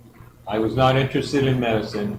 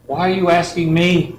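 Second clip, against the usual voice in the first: higher, louder disbelieving question; incredulous; 6 semitones higher, 4 dB louder than usual. Retold or imitated. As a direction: ask it as if the request makes no sense. Baffled by stranger's request.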